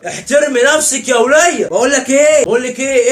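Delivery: furious spouse shouting back; loud marital row; angry shout. Acted. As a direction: yell it high and loud, slowing on احترمي. Quarrel escalates.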